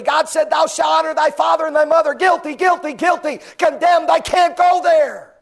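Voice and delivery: climbing from loud to a full high shout; building to shouted confession. Acted. Sinner confesses each broken commandment, building to shouts. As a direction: build it up to a cry of guilt.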